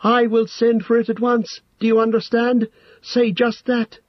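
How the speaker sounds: piercing high monotone, as if possessed; shrill, toneless. A narrator's reading. Entranced hobbit passing on the message, shrill and toneless. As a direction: recite it very high without inflection. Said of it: "he cried in a shrill and toneless voice".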